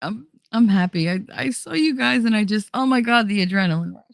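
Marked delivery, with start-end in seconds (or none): none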